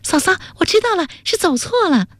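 high, quick cry of discovery; high, eager girl calling to sister-in-law; excited calling out. A narrator's reading. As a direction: burst out with the discovery, pitched high. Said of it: "大声说".

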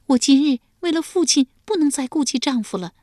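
high-pitched, hurried tearful plea. A narrator's reading. Daughter tells her mother she can no longer mind her husband, tearful. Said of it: "遂双眼流泪道".

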